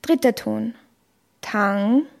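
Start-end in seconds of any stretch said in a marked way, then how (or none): 1.4-2.1 Tang dipping low, then rising; third tone. Shown as a demonstration. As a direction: sink Tang down and bring it back up. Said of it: "fallend steigender Ton". (Tang im dritten Ton, fallend-steigend)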